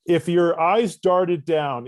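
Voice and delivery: usual speaking voice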